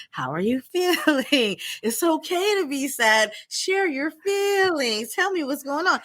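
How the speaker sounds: high, slow sing-song mom voice; nagging caring mom. Retold or imitated. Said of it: "tired of hearing me say".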